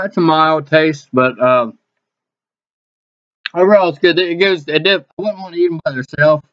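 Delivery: words muffled by chewing; talking while chewing. Real, not acted. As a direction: mouth partly full of food. Seen 5: Cheeks bulge, lips pursed, jaw working throughout; no chip visible.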